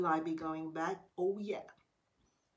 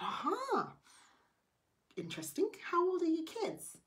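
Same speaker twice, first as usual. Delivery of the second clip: intrigued aha; 12 semitones higher than usual. Retold or imitated. She probes the barber about his kids.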